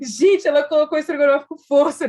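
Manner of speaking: laughing. Real, not acted. Telling friends about the overfilled plate, laughing. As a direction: chuckle as you tell it.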